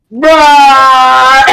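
one word held out loudly, level pitch; long loud bruh. Retold or imitated. Kid replying to the pizza guy, long loud bruh. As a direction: drag it out as a loud mock comeback. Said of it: "I was like".

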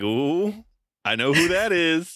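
high, pleased little ooh of knowing; retelling a proud moment of recognition, high and pleased; smug recognition. Retold or imitated. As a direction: act out catching on with delight. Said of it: "I was like".

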